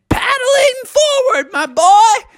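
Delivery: very high, drawn-out shout; calling back. A narrator's reading. Froggy answers Bucky on the river, calling back. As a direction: holler back to your crewmate.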